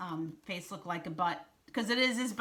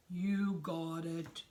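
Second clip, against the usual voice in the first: slow, drawn-out character reply; unhurried Shark figure; 2 semitones lower, much slower than usual. A narrator's reading. Reader voices Shark agreeing to Snake's order, unhurried. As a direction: draw the words out slowly, unruffled.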